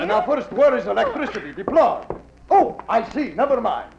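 fake Russian accent. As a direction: bustle about looking for the plug in a fake accent. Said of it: "like the accent, the beard".

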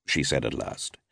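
plain reading voice

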